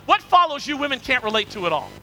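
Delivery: usual speaking voice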